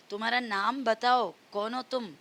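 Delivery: ordinary talk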